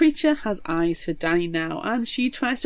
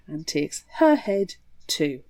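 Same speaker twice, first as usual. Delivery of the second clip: ordinary talk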